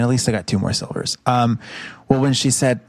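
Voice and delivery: ordinary talk, nothing marked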